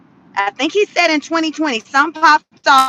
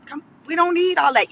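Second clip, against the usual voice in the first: higher, soft, dismissive mock quote; mocking the officials; 4 semitones higher, 6 dB quieter than usual. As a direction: mimic officials brushing it off. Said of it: "they still on this".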